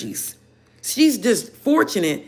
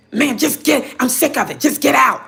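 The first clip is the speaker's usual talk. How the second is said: high, loud sneering mimic; mocking the cashier; 6 semitones higher, 3 dB louder than usual. Retelling the cashier cutting her off, high, loud mimicry. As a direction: snap it out like the fed-up cashier. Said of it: "For your".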